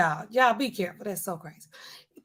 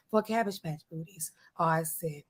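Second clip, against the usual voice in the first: usual speaking voice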